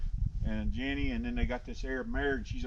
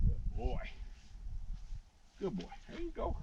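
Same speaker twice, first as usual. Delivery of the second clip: soothing the horse; 6 semitones higher, 10 dB quieter than usual. Real, not acted.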